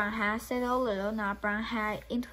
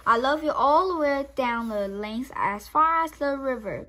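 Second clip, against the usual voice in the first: louder eager call; crying out; 4 semitones higher, 7 dB louder than usual. Acted. Little hare crying out how far his love goes, louder. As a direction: call it out across the fields. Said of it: "cried Little Nut Brown Hare".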